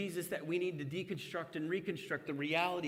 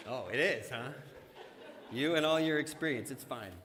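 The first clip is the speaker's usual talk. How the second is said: amused, laughing sarcastic dad; dad teasing son's confidence; mock sarcasm; 3 dB louder than usual. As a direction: tease the kid's confidence with a laugh.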